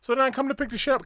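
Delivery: ordinary delivery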